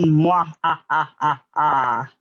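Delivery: low, spaced-out fake evil cackle; mock villain laugh. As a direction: gloat like a pantomime villain, low and deliberate. Villain laugh at last student called.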